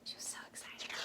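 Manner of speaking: soft whispered delight; whispered narration. A narrator's reading. Storyteller whispering Lisa's excitement. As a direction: whisper it warmly.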